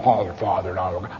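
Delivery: low, soft, mock-holy voice; mocking pious voice. Narrator mocks how church people talk, low and soft. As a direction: parody a churchgoer's piety. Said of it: "I can't relate to".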